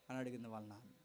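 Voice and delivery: normal talking voice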